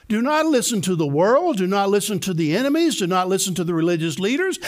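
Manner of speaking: ordinary talk, nothing marked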